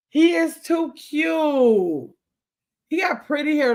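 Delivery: drawn-out, slow gushing over a cute dog. Real, not acted. Grandma gushing over a photo of Rocky, slow and delighted. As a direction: stretch each word out, delighted.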